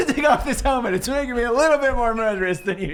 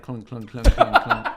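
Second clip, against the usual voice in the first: imitated clunking; 8 semitones lower than usual. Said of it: "knocking on helmet, making the sound".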